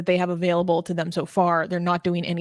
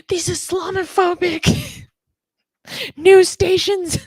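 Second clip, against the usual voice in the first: much higher, slowed mocking voice of a protester; sarcastic mock voice; 12 semitones higher, 5 dB louder than usual. Host mocks the protesters for his cohost in a much higher sarcastic voice. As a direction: play the zealot who thinks a caliphate fixes everything.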